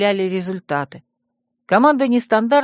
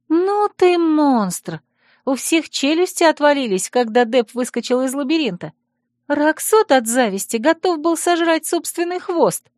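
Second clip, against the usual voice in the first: excited chirp; 8 semitones higher than usual. Acted. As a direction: gush with delight in a bright high voice. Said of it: "восхищённо прощебетала".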